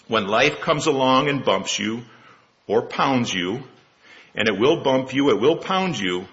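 usual speaking voice

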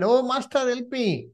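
a hint of Peter panicking in the waves; Peter's cry for help. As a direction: cry out to the Master as if going under. Peter sinking in the sea.

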